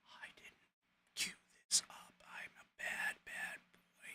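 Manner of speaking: unvoiced self-scolding whisper; whispered sheepish aside. Real, not acted.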